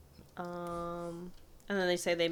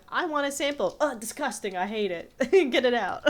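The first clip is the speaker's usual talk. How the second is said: sassy computer; 6 semitones higher, 6 dB louder than usual. Retold or imitated. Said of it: "the sassy computer".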